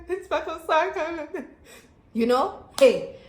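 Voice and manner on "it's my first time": high, slow laughing wail; hammed-up tearful whine; mock crying. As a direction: whimper it, half laughing, half sobbing. Re-enacting crying in shock.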